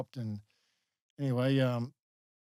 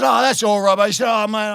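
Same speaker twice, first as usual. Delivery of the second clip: loud, high exclamations of relief, imitated; relieved; 8 semitones higher, 12 dB louder than usual. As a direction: cry out as if let off the hook. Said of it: "almost like letting him off the hook".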